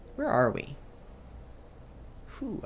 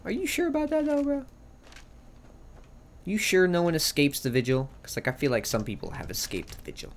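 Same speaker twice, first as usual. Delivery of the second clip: high mocking doubt; teasing skeptical; 6 semitones higher than usual. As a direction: needle him with doubt. Teasing the vigilant.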